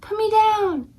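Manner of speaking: high, mock-panicky plea; frantic knight, high voice; knight pleading. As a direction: beg to be put down. Knight held by dragon.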